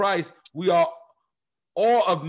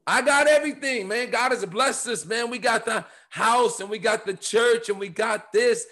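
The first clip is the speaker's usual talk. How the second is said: smug rich church; 6 semitones higher than usual. Pretend. Mocking a complacent rich church.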